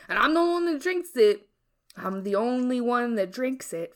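ordinary talk

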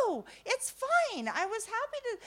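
retold reassurance in a high-pitched voice; hurried reassurance. Grandma reassuring the embarrassed woman, high-pitched.

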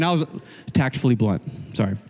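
ordinary delivery

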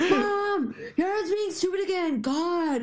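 high whiny call to Mom; tattling kid. Retold or imitated.

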